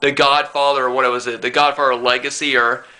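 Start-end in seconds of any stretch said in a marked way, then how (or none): none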